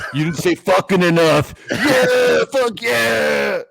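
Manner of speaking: mock wedding objection, escalating to whooping shout; hammed-up rowdy shout. Objector interrupting a pretend wedding, hammed-up shout. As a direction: build to a high, drawn-out yell.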